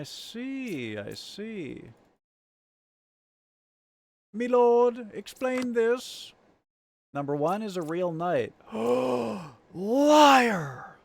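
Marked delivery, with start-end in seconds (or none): 4.3-6.3 mock royal plea. Mock dramatic plea to game host. 8.7-9.5 dramatic gasp. Acted. Dramatic reaction to reveal. 9.7-11.0 high shouted "Liar!"; shouted accusation. Acted. Accusing in the game.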